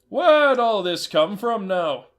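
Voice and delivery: a wary trader questioning a buyer; suspicious horse trader. Acted. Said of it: "said the horse trader".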